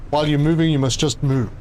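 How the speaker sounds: ordinary delivery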